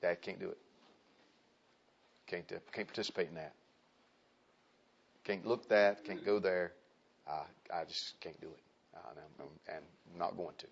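low, soft and calm; calm refusal. Acted. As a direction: decline quietly, without confrontation. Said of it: "you just calmly go".

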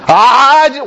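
loud, high stammer; hammed-up flustered objector; mock spluttering protest. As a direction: burst out as if lost for words. Acts out objector lost for words.

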